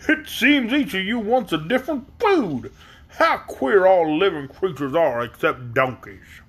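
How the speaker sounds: drawn-out exclaiming donkey monarch; puzzled donkey king. A narrator's reading. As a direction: marvel at these odd guests, stretching the words.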